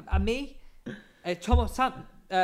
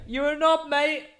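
quoted insult; 12 semitones higher, 4 dB louder, slower than usual. Retold or imitated. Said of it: "And he went".

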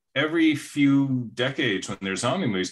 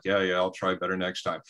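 lower, softer sheepish brush-off; mock Soderbergh; 5 semitones lower, 4 dB quieter than usual. Mock director brushing off writer's complaints, lower and softer. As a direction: shrug it off like a meek director. Soderbergh voiced in commentary story.

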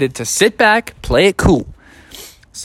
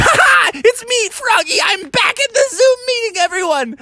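very high, excited squeal; triumphant reveal. Acted. Froggy pulling off his disguise, triumphant high squeal. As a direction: crow it to the whole class.

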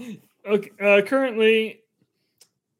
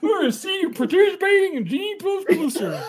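put-on Swedish accent; 10 semitones higher than usual. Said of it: "pretend I'm doing a Swedish accent".

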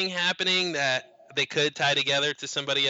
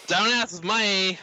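slow mock Aussie "Don't ask me", pitched higher; Australian accent; 6 semitones higher, much slower than usual. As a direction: echo the line back in a hammed-up Australian drawl. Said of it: "That's my Australian".

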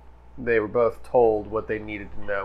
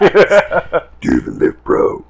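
gym bro; 8 semitones higher, 11 dB louder than usual. Acted. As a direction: bark it like a meathead.

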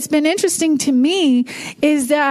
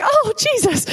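mock startled cry; 9 semitones higher than usual. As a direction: re-enact a sudden fright at a flare-up. Retelling a grill flare-up scare.

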